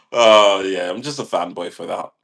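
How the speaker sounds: ordinary talk, nothing marked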